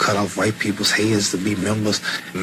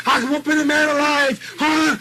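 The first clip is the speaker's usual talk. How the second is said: challenging boast. Retold or imitated. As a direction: dare any man to fight.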